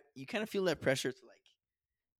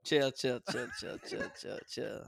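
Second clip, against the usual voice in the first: laughing; slower than usual. Real, not acted. Laughing while saying chill.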